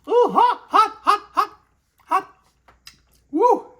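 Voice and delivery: high, loud yelps with hot food in mouth; mouth full of hot food. Real, not acted. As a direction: yelp through a burning mouthful. Just bit into steaming pizza.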